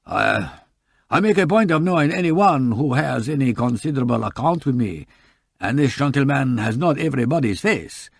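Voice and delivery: put-on polite shopkeeper with a light French accent.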